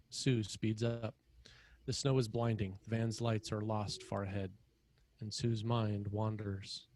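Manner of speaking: ordinary talk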